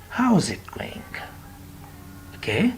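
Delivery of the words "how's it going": whispered "How's it going?". Shown as a demonstration. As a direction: breathe it without voice.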